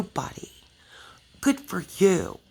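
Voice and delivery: hushed mocking whisper; sarcastic whisper. Real, not acted. Sarcastic aside.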